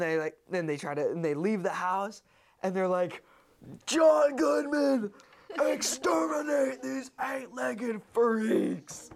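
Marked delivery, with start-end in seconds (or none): 3.9-9.1 high, loud, drawn-out cry for help; hammed-up summons to the hero; mock desperate call. Family calling exterminator for help, mock desperate shout.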